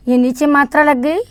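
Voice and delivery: ordinary talk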